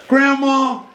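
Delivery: high-pitched coaxing granddaughter; imitating a daughter pacifying her grandmother; pleading daughter. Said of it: "the daughter gets us off the hook".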